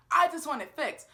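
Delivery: retold frustrated plea; exasperated demand. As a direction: insist on it, fed up. Acted demand in the argument.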